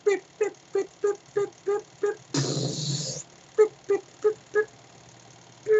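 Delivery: high, thin imitated beeping; tracker beeps. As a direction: copy the motion tracker's beep. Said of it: "tracking device".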